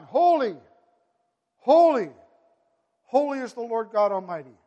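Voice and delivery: host of heaven crying holy. Retold or imitated.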